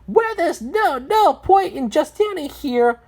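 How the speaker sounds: high character voice, urging action. Acted. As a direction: urge them on in a high voice. Character urges the group onward.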